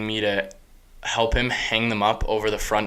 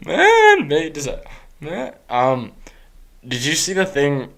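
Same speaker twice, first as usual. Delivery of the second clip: higher, slow, put-on YouTuber voice; Joe Santagato; 5 semitones higher, 5 dB louder, slower than usual. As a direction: ham it up like a YouTuber. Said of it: "I was trying to become Joe Santagato".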